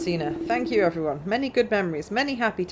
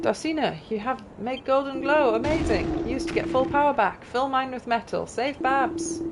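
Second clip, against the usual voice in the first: a touch higher, grateful quest-giver read; lightly voiced game character; 7 semitones higher than usual. Gamer reading a character's gratitude to the hero, lightly voiced. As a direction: read the NPC's thanks as a cheery side character.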